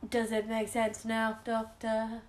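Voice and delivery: slow, mock-Dalek delivery. Podcaster mimicking the Dalek's entrance, slow robotic imitation. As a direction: draw each word out like a Dalek. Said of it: "it was just like".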